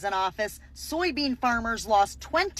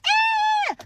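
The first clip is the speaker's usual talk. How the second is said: sudden shriek far higher and louder; mock shriek; 5 dB louder than usual. Comedian reacting to the voters with a mock shriek. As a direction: let out a short exasperated shriek. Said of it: "Now I'm like".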